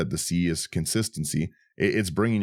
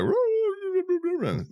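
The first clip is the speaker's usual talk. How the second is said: high, drawn-out walrus cry. Pretend. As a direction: stretch it out like a walrus call. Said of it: "your walrus impression".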